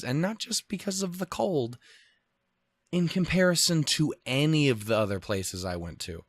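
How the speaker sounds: normal talking voice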